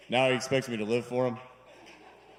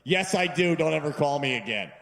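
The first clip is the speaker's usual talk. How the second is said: curt friend; 4 semitones higher, 6 dB louder than usual. Retold or imitated. Friend cutting him off.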